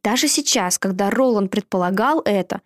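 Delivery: plain reading voice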